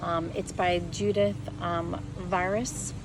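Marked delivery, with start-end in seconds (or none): none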